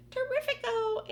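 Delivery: very high, loud gleeful cry; excited cry. Acted. Aunt Sponge clapping round the peach, high gleeful cry. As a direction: crow it out in delight. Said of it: "Aunt Sponge cried out".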